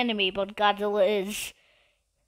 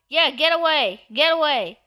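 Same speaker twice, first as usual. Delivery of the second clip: raised, higher-pitched calls; driving them off; 4 semitones higher than usual. Real, not acted. Player chasing another out of the way, driving them off. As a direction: call out to chase them off.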